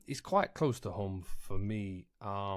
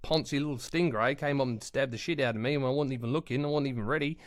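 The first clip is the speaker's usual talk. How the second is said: put-on loud, higher, indignant Australian accent; Steve Irwin; 3 semitones higher, 4 dB louder than usual. Dead Steve Irwin answering whether he died happy, indignant Australian. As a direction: protest like an aggrieved Aussie bloke, broad accent. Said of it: "why am I doing a bloody Australian accent".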